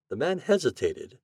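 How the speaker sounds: ordinary delivery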